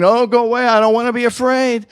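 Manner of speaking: rushed, panicky go away; anxious refusal. Pretend. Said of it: "Instead of saying".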